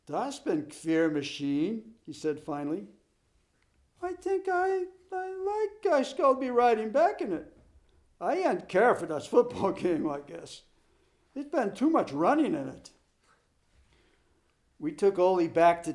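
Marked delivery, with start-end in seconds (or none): 0.1-1.9 put-on slow, wondering Swedish accent. Ole's immigrant dialect voice. 4.0-12.9 put-on Swedish accent. Ole's immigrant dialect voice.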